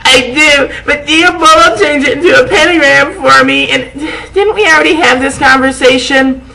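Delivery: high, hammed-up pained boy; lightly voiced vampire boy. Vampire answering her, in a hammed-up strained voice.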